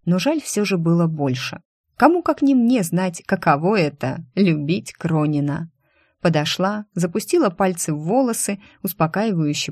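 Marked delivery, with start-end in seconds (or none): none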